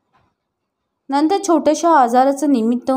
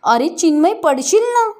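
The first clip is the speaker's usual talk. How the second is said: high frightened call, voice jumping up at the end; frightened shout; 7 semitones higher than usual. Acted. Aunt warning nephew he will fall, high frightened shout. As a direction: yell a warning to the boy in the window. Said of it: "मी केवढ्यांदा तरी ओरडले".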